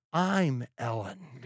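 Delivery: tired mother's deadpan reveal; weary. A narrator's reading. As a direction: let it drop slowly like an exhausted mom. Worn-out mother's punchline.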